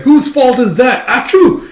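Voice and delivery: high, loud complaint ending in fake sneeze; sneezing retort. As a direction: argue back mid sneezing fit. Sneezing teller argues back.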